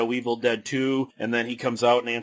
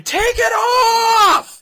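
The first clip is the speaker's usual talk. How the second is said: retold fan screaming, much higher and louder; mock scream; 8 dB louder, much slower than usual. Lady in the audience screaming at [name], a loud mock shriek. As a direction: shriek it out like a rowdy fan in the crowd. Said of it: "This lady just starts screaming".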